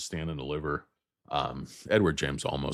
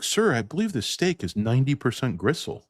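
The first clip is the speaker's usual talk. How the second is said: raised, mildly put-on customer voice; formal complaint pitched higher; complaining diner; 7 semitones higher, 4 dB louder than usual. Diner objecting to a gristly steak, higher mock-polite complaint. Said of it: "It's like".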